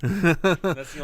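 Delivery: loud, slow gruff voice; lightly voiced hardened guard; prison guard. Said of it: "sound like the prison guard from Shawshank Redemption".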